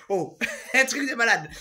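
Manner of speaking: high, loud burst of laughter; laughing exclamation. Real, not acted. Vlogger appalled by their own smelly shirt, laughing out loud. Cracking up over the stinking shirt.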